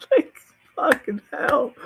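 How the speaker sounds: high, loud, through laughter; laughing disbelief. Real, not acted. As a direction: crack up while saying it. Laughing over shocking book plot.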